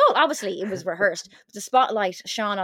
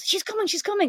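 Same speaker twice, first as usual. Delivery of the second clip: high excited she's coming; mock excited warning; 10 semitones higher than usual. Raj warning the audience she is coming, mock excited. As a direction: announce her arrival with glee. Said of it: "Raj goes".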